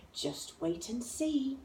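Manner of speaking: soft, slow, knowing; sly hush. A narrator's reading. Mouse hinting to the child, soft and sly. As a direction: tease it quietly. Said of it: "then said".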